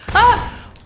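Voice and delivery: high startled shout; scared horror game streamer. Real, not acted. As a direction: shaken and yelping.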